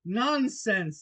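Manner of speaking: firm, high, loud dismissal; loud and decided. Acted. Alice standing up to the raging Queen, loud and decided. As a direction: cut them off decidedly. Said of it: "said Alice very loudly and decidedly".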